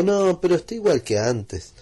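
put-on a light touch of a puzzled kid; lightly voiced child.

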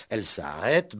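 ordinary talk, nothing marked

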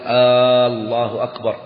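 first vowel of الله drawn out long, like a question "آلله"; stretched first hamza. Shown as a demonstration. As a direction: say الله with a long opening "aa" before أكبر. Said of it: "ألا يمد الهمزة". (مدّ همزة «الله» في أولها)

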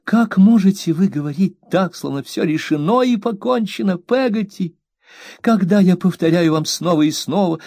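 high, aggrieved plea; mother scolding her maid, high and hurt; wounded young mother. A narrator's reading. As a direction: play the hurt, fragile mistress. Mother voiced in hurt quarrel.

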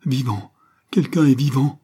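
high, loud cry of desperate hope; clutching at the one word that saves her; shrill outburst of frantic hope; cried out. Acted. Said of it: "entendit et cria".

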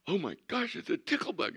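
hammed-up high squeal to a child; mock amazement. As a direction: act thrilled to find a bug.

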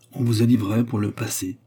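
plain reading voice

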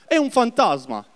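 high, startled cry of fear; frightened cry. Acted. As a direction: cry out as if seeing a ghost. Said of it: "si turbarono e dissero".

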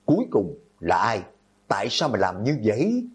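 ordinary delivery, nothing marked

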